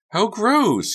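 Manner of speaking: very high, loud disgusted cry; exclaiming in disgust. Acted. Carrie sees the mess, high loud disgust. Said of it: "Carrie exclaimed".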